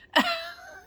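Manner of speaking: short, very high laugh; high squeal of laughter. Real, not acted. Mocking Dan's contradiction, a high laugh. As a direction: let out a squeaky giggle.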